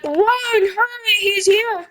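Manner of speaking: high squeaky panicked warning; mock panic. Kid warning friends the villain has come, high mock panic. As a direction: squeal the alarm like fleeing. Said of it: "then he's like".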